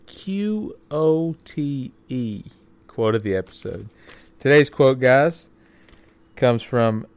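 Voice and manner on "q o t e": spelled out slowly. Shown as a demonstration. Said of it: "the quote of the episode".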